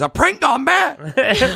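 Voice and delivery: high, slow, disbelieving echo; incredulous husband. Retold or imitated. Husband echoing the excuse in disbelief. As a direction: repeat it back in disbelief. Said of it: "He goes".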